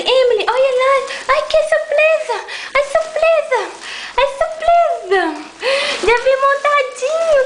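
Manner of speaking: high, bright sing-song gasps of delight; excited surprise. Real, not acted. Mother showing her baby the present, excited high surprise.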